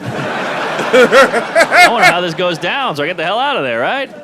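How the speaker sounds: laughing mock earnest. Joking to his girlfriend about why he watches, laughing. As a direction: sell the excuse while cracking up.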